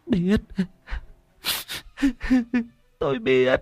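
small, high, broken-up crying voice; tearful sobbing admission. A narrator's reading. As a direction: choke the admission out between sobs. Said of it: "Bà mẹ thằng Lam mếu máo".

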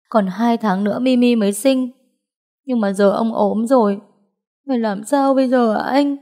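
worried, high-pitched appeal; anxious pleading. Acted. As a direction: voice rising as she pleads for help. Distressed wife asking husband what to do.

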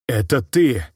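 mildly acted startled cry; trembling cry. A narrator's reading. Artyom recognises the girl who replaced his mother, trembling cry. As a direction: call out as if stunned to recognise someone. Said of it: "дрожащим голосом выкрикнул Артём".

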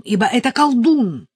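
loud, high, commanding sorcerer voice; evil sorcerer. A narrator's reading. Sorcerer ordering her to circle against the sun, commanding. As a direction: ring it out as a wicked sorcerer's decree. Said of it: "говорит злой колдун".

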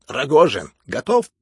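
loud, sharply rising call. Acted. Heroine asks Rogozhin if he is ready, calling out. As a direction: call out high and loud to rally him. Heroine calls out to her man.

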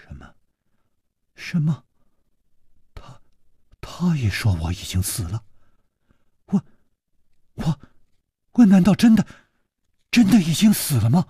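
put-on shaken, halting girl's disbelief, rising high; frightened girl learning she's thought dead; trembling voice. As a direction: stammer it out in growing dread. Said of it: "她颤着声音说".

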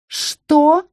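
high, menacing "what"; affronted, high-pitched hiss; hissed question. A narrator's reading. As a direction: hiss it as a rising challenge. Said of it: "прошипела".

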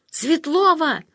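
calling a name down the phone; loud call down the line. A narrator's reading. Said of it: "позвала из трубки".

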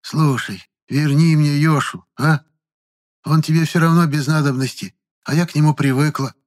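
put-on high-pitched, coaxing old woman; pleading. A narrator's reading. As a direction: plead to get it back. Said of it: "И просительно прибавила".

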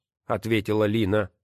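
ordinary delivery, nothing marked

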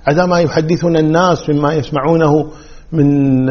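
normal talking voice

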